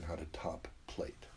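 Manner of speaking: soft unvoiced whisper of the key phrase. Real, not acted. Urging listeners to learn it, in a whisper. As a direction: whisper it like a secret. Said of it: "my urging for everyone".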